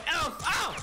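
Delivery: high yelps in quick succession; playful high-pitched yelping; high-pitched oof. Pretend. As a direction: fire off high yelps for the dare. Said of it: "say oof in a high-pitched voice".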